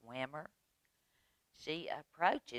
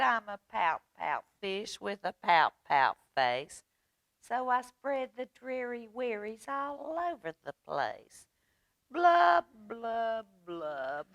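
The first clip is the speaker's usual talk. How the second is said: high sing-song refrain with blubs sinking; mock-dreary fish chant, pitched up; glum pout-pout fish; 7 semitones higher, 5 dB louder than usual. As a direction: chant it in rhythm, stretching the dreary line.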